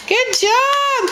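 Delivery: high cheering praise; excited praise. Real, not acted. Cheering toddler's clap.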